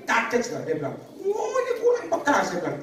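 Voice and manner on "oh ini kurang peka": mocking complainer. Church members complaining about pastor.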